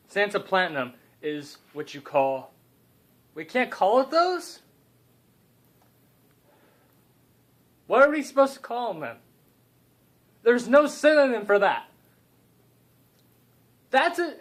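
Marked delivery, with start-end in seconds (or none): 3.3-14.3 excited aside. Real, not acted. Comic aside about legal names.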